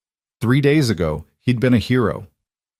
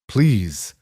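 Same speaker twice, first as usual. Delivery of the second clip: begging tone. Acted. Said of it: "hated the begging tone".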